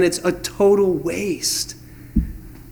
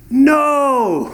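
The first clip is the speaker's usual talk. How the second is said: higher, hammed-up fisherman's no; mock sheepish no; 7 semitones higher than usual. Disciples admitting to Jesus, high mock sheepish no. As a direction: toss it off like an embarrassed fisherman. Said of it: "They answer him".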